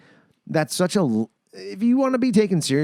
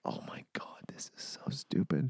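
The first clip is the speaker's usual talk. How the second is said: breathy disbelieving whisper; 15 dB quieter than usual. Retold or imitated. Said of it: "I'm like".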